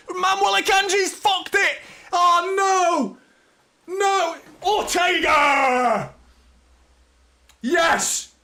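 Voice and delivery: screaming high and loud at the screen; wild fan outburst at a near-miss; anguished shout. Real, not acted. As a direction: yell it like your heart just stopped. Live match reaction, goal chance.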